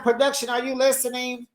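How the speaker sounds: high-pitched, incredulous telling-off; mock exasperation. Reviewer to the show's production crew, in high mock exasperation. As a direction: scold them playfully, pitched right up.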